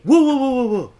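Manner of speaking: loud high startled whoas. Real, not acted. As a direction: fend off the jumping dog. Dog jumping on him.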